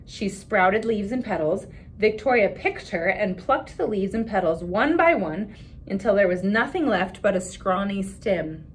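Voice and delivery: put-on expressive read-aloud for children; story narration. A narrator's reading. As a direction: read the picture book to the class with voices. Reads picture book aloud.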